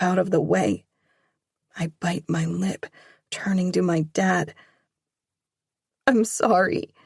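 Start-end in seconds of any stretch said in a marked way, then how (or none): 6.1-6.9 apology with a breaking voice; tearful daughter saying sorry to dad. Acted. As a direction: let the voice crack as you apologise. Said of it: "My voice breaks".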